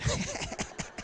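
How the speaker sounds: soft, breathless staccato snicker; Bush-style snicker. Acted. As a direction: snicker under your breath at your own joke. Bush impression chuckles at his joke.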